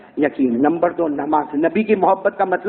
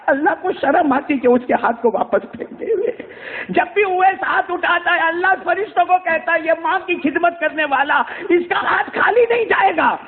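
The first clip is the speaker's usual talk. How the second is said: tearful preaching; 9 semitones higher than usual. Acted. Preacher weeping over the story.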